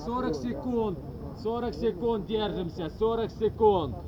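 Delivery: ordinary delivery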